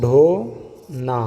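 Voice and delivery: ڈھونا stretched very slowly, aspirated dh clear; slow sounded-out word. Shown as a demonstration. Teacher slowly sounding ڈھونا for students. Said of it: "اسی طریقے سے".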